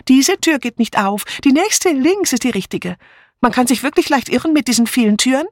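high, quick and chatty; bustling helpfulness; fluttery spinster. Acted. Miss Bunner explaining the doors to the inspector, high and quick.

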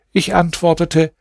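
usual speaking voice

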